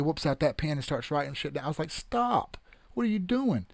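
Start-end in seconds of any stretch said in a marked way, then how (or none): 1.9-3.7 exasperated stop. Retold or imitated. Pleading with coworkers signing up.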